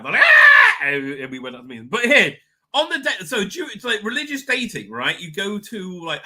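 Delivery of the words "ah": very high, loud mock shriek; freaked-out scream. Family learning of the engagement, shrieking. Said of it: "they freaked out".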